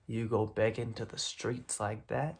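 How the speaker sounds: ordinary delivery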